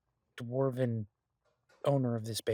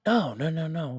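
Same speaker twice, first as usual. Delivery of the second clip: louder, higher protest; hasty denial; 5 semitones higher, 8 dB louder than usual. Acted. Partner rushing to deny selling the restaurant, hasty denial. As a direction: jump in to head off the worry. Hastily correcting partner's alarmed assumption.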